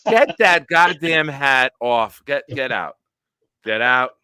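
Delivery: fake-kick the guest out for his hat; mock outrage. Host jokingly throwing a guest out over his team hat, hammed-up anger.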